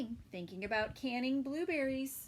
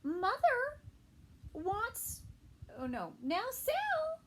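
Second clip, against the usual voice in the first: much higher, sing-song mom; high motherly voice; 11 semitones higher, slower than usual. Acted. Sal's mother talking to her child, sing-song high.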